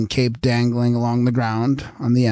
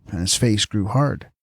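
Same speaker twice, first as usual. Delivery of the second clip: ordinary delivery, nothing marked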